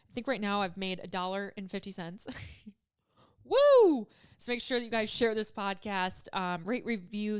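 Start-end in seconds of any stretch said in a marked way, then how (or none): 3.4-4.1 loud, high-pitched "woo" after a laugh; big joking cheer; celebratory woo. Pretend. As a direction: whoop it up over a small win. Mock celebration of tiny earnings.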